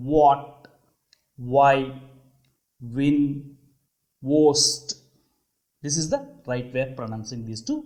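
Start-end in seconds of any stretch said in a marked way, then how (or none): 0.1-4.9 "What, why, win, worst" starting on rounded lips; each word alone, with a clear W. Shown as a demonstration. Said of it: "rounded lips ఉండండి".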